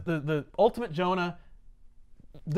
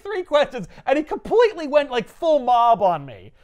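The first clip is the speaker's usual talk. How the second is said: exasperated; 9 semitones higher, 6 dB louder than usual. Retold or imitated. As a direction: whine in disbelief. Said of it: "Yurik is just like".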